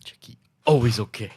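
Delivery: lightly voiced Jackie Chan quote; a hint of Jackie Chan's accent; Jackie Chan impression. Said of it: "quoting Jackie Chan".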